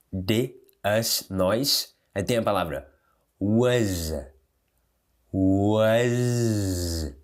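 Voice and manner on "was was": "was" as "wuz", drawn out on a buzzing Z; Z sound ending. Shown as a demonstration. Teacher drilling the word, "was" ending in a long Z. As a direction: stretch "was" into a long voiced Z. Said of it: "termina com som de Z".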